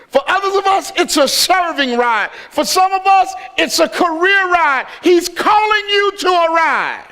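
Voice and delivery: building from measured lines to high, forceful preaching. Real, not acted. Pastor urging the congregation onto God's ride, impassioned preaching.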